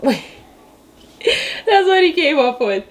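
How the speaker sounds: laughing through the words; breaking into giggles, slowed right down. Real, not acted. Laughing at baby's outfit choice.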